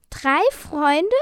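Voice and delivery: high, loud, rising question; astonished. A narrator's reading. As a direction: echo it in amazement. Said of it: "fragten die Drachen verwundert". (verwundert nachgefragt, Stimme steigt)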